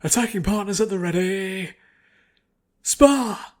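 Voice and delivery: loud, high drill-sergeant order, rising into "Spar!"; shouted command. Acted. Instructor starting the sparring round, shouted order. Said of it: "On the command".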